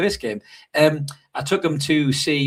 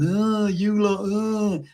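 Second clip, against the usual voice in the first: higher, scornful fan groan; imitating a disappointed supporter, pitched up; mock fan jeer; 6 semitones higher, much slower than usual. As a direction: sneer at the players like a fed-up fan. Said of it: "giving them shit and going".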